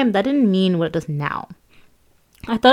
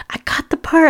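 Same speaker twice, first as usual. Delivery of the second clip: high, louder excited shriek; shrieking with joy; 9 semitones higher, 4 dB louder than usual. Retold or imitated. On hearing she got the part, a high joyful shriek. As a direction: squeal the news out. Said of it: "I shrieked".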